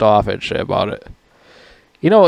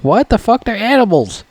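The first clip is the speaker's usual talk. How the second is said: incredulous rant; 12 semitones higher than usual. Retold or imitated. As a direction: rant in disbelief at the movie's script. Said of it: "I'm like".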